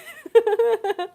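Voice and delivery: high, loud rapid giggle; excited cackle. Real, not acted. Streamer about to reveal the snack, excited cackle. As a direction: cackle with anticipation.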